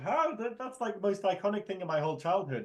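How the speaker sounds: high indignant put-on voice; high hammed-up outrage; mock outraged fan. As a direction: gasp it like an offended fan. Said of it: "they were just like".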